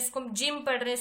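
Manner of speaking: ordinary delivery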